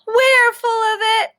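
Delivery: high, slow squeaky agreement; proud guinea pig. Acted. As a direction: cheerfully own it like a little pet. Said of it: "says Minnie".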